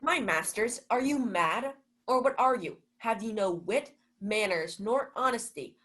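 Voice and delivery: angry scolding. Acted. Malvolio scolds the late revellers.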